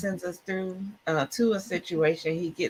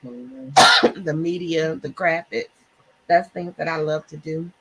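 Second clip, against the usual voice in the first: ordinary delivery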